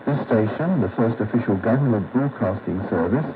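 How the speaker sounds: usual speaking voice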